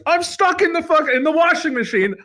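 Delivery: high, fast breathless mock girlfriend.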